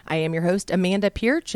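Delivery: ordinary delivery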